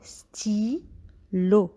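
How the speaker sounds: stylo split into sty and lo; teacher scanning a word for pupils; scanned by syllable. Shown as a demonstration. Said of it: "Nous scandons le mot".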